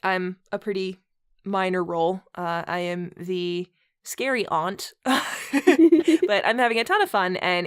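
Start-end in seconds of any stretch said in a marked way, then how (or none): none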